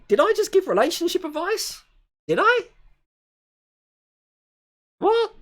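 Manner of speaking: baffled, can't believe what he just said; incredulous surprise. Real, not acted. Disbelief at his own advice.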